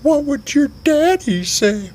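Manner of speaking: very high, slow squeaky question; a high teasing squeak; Sugar Tits voice. Acted. Sugar Tits asking about the father, high slow squeak. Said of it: "Sugar Tits looks at me ... and goes".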